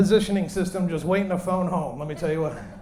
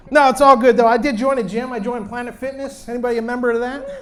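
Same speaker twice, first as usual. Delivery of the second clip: ordinary delivery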